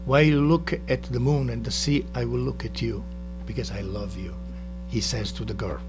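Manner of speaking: ordinary talk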